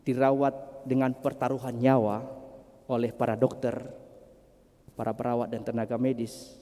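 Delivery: normal talking voice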